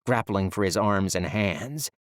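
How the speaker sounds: ordinary delivery, nothing marked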